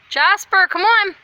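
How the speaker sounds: loud, high call to a dog. Real, not acted. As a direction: shout for the dog to come. Calling the dog.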